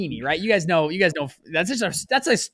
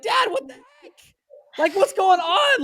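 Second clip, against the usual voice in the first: high, incredulous outburst at dad; retold disbelief; 4 dB louder, slower than usual. Son confronting his rich dad, high incredulous. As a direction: raise it high like you can't believe it. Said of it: "I was like".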